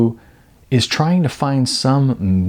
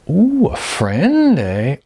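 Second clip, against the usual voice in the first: drawn-out, much higher sly Ooh, then a knowing eh; teasing insinuation; 7 semitones higher, much slower than usual. Acted. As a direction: needle him about his new friend. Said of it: "Beth raised an eyebrow".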